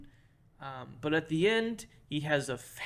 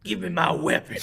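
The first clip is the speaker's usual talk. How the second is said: loud mock-Southern movie villain; Southern accent; 5 semitones higher, 9 dB louder than usual. Quoting the bad guy's fantastic line, in a hammed-up Southern accent. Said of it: "he sounds weirdly, uh, Southern".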